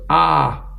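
high, mock "ah"; wordless open-mouth cry. Husband trying to get his wife's attention, high open-mouthed cry. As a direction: imitate a jaw stuck wide open.

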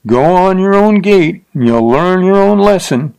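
put-on calling after the fleeing girl; calling after her. A narrator's reading. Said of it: "and called".

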